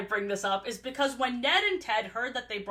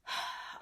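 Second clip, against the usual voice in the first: quiet exhaled sigh; reluctant breathy sigh; heavy sigh; 11 dB quieter than usual. Acted. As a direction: sigh before a reluctant confession. Sighs before reluctant confession.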